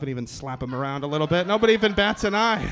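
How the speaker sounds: ordinary delivery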